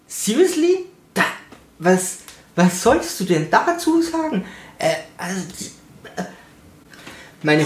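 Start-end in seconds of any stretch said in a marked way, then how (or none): 0.1-2.2 high disbelieving "seriously?"; can you believe this, rising high; incredulous. Real, not acted. Asking the audience about the staff who watched, incredulous and high.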